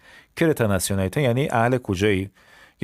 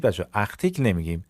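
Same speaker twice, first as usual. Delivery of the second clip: normal talking voice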